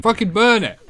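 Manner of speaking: high, strained mock-panicked cry; hammed-up horror. Gamer reacting to horrifying flying enemies, in high mock panic. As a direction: cry out in exaggerated revulsion, pitch way up.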